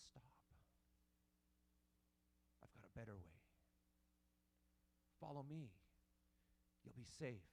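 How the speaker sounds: tender hushed reassurance; soft gentle voice. Pretend. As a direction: say it quietly, almost a whisper. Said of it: "But in reality".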